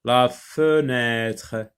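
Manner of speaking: "La fenêtre" slowly, as one joined phrase; careful word reading. Shown as a demonstration. Teacher reading the list for pupils, slow whole words. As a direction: read "La fenêtre" slowly without breaking it up. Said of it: "on va les lire d'abord".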